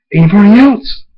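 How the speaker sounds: loud, high villain's call; boastful shout; taunting challenge. Acted. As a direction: dare the others to step up.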